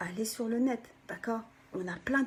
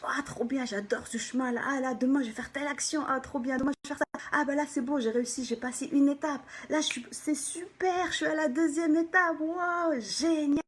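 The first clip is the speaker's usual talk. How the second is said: higher-pitched, gushing celebration; acted delight; 5 semitones higher than usual. Coach voices someone thrilled by their progress, excited. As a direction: cheer each little step, building in pitch and energy. Acts out celebrating each step.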